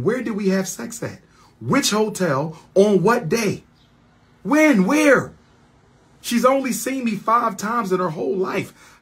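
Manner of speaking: high-pitched, incredulous questioning; indignant denial. Real, not acted. Accused man demands when and where, high and indignant.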